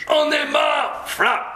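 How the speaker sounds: mock angry shout. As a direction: blow up like a fed-up teacher. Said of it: "je suis très en colère".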